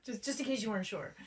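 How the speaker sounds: quick words through a real laugh. Real, not acted. Teasing co-host about healing, laughing.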